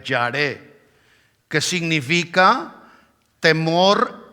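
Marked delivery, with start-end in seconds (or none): 0.0-0.8 "yaré" with stress on the final E, ya-RÉ; "yaré" said slowly, the E accented; Hebrew word. Shown as a demonstration. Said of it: "acento en la E".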